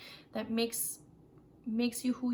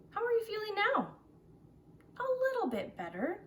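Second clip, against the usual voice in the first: put-on child recovering; 12 semitones higher, faster than usual. Captain checks on the child.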